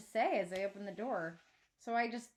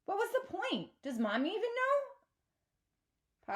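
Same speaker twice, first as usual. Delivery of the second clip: climbing higher with each hurt question; upset outburst; 9 semitones higher than usual. Acted. Girl confronting her father about selling the truck, upset and pleading. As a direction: pour out the frustration, last question slowing. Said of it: "I burst out".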